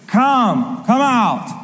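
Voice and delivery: living creature's summons. Acted. Second living creature calls out a horseman, summoning. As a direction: call them forth like the first creature.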